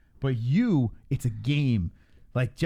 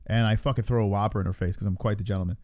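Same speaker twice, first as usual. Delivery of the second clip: usual speaking voice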